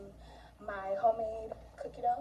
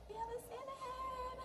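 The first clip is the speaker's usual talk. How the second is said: much higher, tiny squeaky voice; high squeaky repeat; 14 semitones higher, 13 dB quieter than usual. Real, not acted. Vlogger repeats her cookie shape name in a squeaky voice. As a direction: squeak the line up high like a cartoon. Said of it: "Okay, we have a Santa head".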